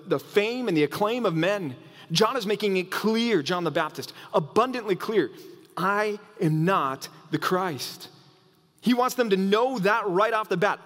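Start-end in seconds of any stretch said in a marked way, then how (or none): 5.8-8.1 slow, deliberate, every word weighted; measured emphatic denial; firm slow denial. Acted. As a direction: say it slowly so no one doubts.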